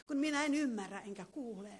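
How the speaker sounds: normal talking voice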